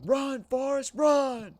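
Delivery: high, loud barked shout; drill instructor yell. Retold or imitated. Instructor yelling at recruits during the run, loud shout. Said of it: "the M T Is yell at you".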